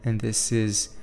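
slow, calm meditation voice with long pauses; guided meditation. Real, not acted. Podcast host guiding listeners into a visualization, slow meditation voice. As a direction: lead them gently through it, unhurried, leaving space between phrases.

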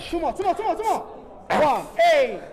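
loud, rising gym yells; shouting. Real, not acted. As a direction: shout the last reps out. Spotter yelling final reps.

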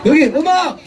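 high, sharp call of 음악; calling out cue. Acted. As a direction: bark the cue up high, again and again. Cueing the band.

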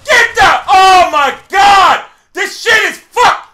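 high, loud, drawn-out yelling; furious shout. Real, not acted. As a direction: lose your temper at the game. Losing temper at the game.

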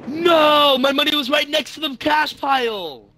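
shocked dismay. Real, not acted. Finds money lost in game.

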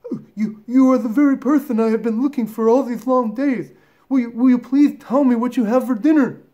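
high, loud, thrilled little elephant; breathless elephant child. Acted. Elephant child finally meeting the crocodile, breathless and thrilled. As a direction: gush in a high, excited young voice. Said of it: "grew all breathless and panted".

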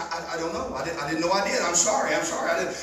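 low, soft, stammered apology; confused apology. Retold or imitated. Woken wife apologizing to husband, low mock stammer. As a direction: imitate a groggy, bewildered apology.

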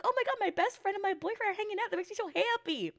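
high, fast, mock-giddy gushing; naive happy gushing. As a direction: play your clueless past self. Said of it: "I'm like".

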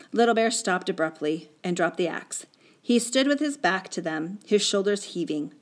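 ordinary delivery

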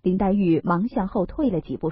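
ordinary delivery, nothing marked

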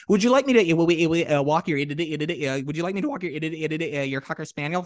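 a hint of Porky Pig, stuttering and fumbling the words; mock Porky Pig stutter. Host imitating how the Porky Pig actor ad-libs the stutter, lightly done. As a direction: stammer through it like Porky Pig. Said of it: "he would do it as".

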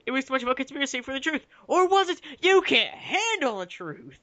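high, rising melodramatic outburst; mock melodrama. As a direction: build to a hammed-up movie climax. Hammed-up climax of the fanfic reading.

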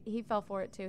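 usual speaking voice

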